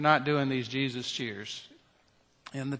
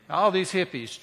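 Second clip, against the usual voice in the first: usual speaking voice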